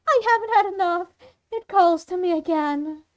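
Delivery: loud, high, rushed outburst; frenzied killer. Acted. Monster Claire refusing to stop killing, frenzied protest. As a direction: burst out with a killer's craving.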